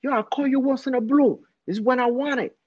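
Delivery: high-pitched mock tough talk; acting tough. Friend cursing at his girl in front of his crew, acting tough. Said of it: "Acting tough".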